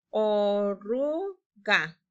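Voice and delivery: "Oruga" drawn out very slowly, falling at the end; stretched slowly. Shown as a demonstration. Teacher models "Oruga" for pupils to echo, very slowly. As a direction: stretch every sound of "Oruga". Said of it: "Repitan conmigo".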